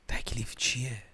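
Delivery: hushed, almost whispered question; playfully tiny, secretive question; very quiet voice. Pretend. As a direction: ask it under your breath.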